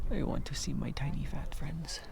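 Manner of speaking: breathy hush so the pikas stay; whisper. Real, not acted. Hiker waits for the squeaking pikas, whispering. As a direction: whisper it fondly, not to scare them. Hushed, hoping to spot pikas.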